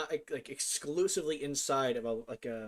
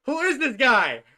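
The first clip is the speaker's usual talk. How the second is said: laughing; 13 semitones higher, 14 dB louder, slower than usual. Real, not acted. A stranger shows up, laughing.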